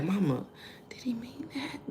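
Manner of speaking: imitated high whispered question; confused hushed aside. Daughter quietly asks mother about a sarcastic remark, confused. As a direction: whisper it like a secret check with mom.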